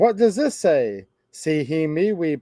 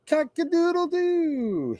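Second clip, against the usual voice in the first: much higher rooster call; rooster crow; 12 semitones higher, 4 dB quieter than usual. Acted. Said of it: "He can sound like a rooster".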